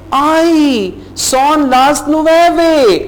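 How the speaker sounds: high, startled Ay, son las nueve; surprised exclamation. Shown as a demonstration. Teacher acts out realising with a start that it is nine o'clock. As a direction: gasp it out as if you just noticed the late hour. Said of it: "ओए नौ बज गए हैं".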